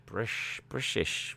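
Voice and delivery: whispered Scottish slur on "British"; a lightly done Scottish Bond voice; Sean Connery impression. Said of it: "Sound like Sean Connery".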